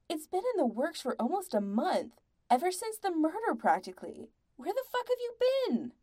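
rising, high-pitched exasperation; exasperated. A narrator's reading. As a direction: climb to an incredulous demand. Bandmate berates them in the quarrel.

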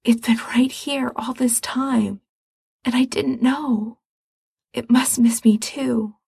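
hushed entranced whisper. Acted. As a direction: murmur it as if in a trance. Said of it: "Frank breathes".

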